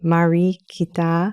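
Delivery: "Mari Kita" slow, syllable by syllable; "Mari Kita" spaced out at half speed; anthem words shown. Shown as a demonstration. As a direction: draw out "Mari Kita" as MAH-ree KEE-tah. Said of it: "comes from the first word of our national anthem".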